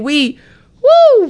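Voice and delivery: ordinary delivery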